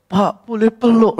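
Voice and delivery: slow, mock-tearful plea; imitated tearful request. Crying fan asking the speaker for a hug, imitated tearful request. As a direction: lightly imitate someone asking through tears. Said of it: "itu sudah nangis gitu".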